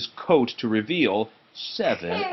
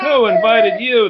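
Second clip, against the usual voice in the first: loud, high cry of outrage; angry cry; 10 semitones higher, 9 dB louder than usual. A narrator's reading. Tailor yelling at the flies, loud and high. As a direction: shout it at the uninvited flies. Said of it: "the tailor cried".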